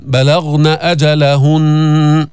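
slow chant of بلغن أجلهن with a full غ; heavy ghain. Shown as a demonstration. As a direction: recite slowly, deepen only the غ of بلغن. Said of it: "بعدين بتفخم الغين". (بلغن بتفخيم الغين)

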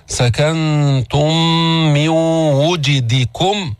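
slow chanted "سكنتم من وجدكم" with humming merged nasals; nasal ghunna. Shown as a demonstration. Teacher drills ghunna, slow chant of "سكنتم من وجدكم" with held hums. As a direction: hold a hum where the words join in "سكنتم من وجدكم". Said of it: "مع الغنة".

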